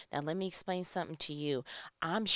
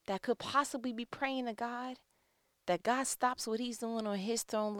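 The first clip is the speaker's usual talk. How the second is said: usual speaking voice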